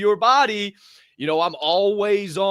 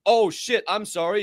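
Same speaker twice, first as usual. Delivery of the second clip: normal talking voice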